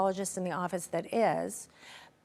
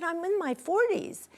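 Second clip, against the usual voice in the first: annoyed protest; 8 semitones higher than usual. Retold or imitated. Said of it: "I was so annoyed with him".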